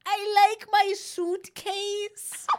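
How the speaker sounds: put-on very slow, high-pitched little boy with a slight German accent; Barron Trump. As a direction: imitate a small boy, drawing out each word. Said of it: "he's a little boy accent when he's a little boy".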